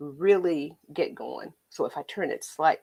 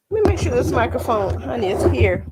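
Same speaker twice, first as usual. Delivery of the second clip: usual speaking voice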